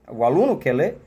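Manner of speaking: ordinary talk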